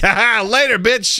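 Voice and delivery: high, gloating sing-song taunt; mock taunt. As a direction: gloat at your wife. Taunting wife as he dozes.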